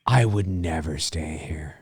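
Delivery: near-whispered comment; low murmured aside. Real, not acted. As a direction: murmur it slowly under your breath. Aside while watching the film.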